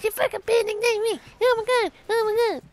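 high, loud mock rage at being knifed; imitating enraged gamers; mock raging players. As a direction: ham up the angry players' outburst, high and squawky. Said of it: "They're just like".

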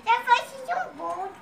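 soft, lightly voiced old granny; toothless granny voice. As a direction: mumble like a toothless grandma. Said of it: "com essa voz de banguela".

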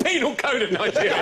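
high, loud disbelieving echo; can't believe it, half laughing; incredulous. Real, not acted. Incredulous echo of host's fact.

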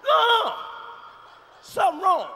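high, loud startled cry; alarmed. Acted. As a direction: jump back in alarm. Mock panic at the wake.

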